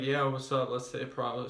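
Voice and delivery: lazy slurring of "Let's say it properly"; showing bad pronunciation to avoid; sloppy mumbled speech. Shown as a demonstration. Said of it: "Don't be like".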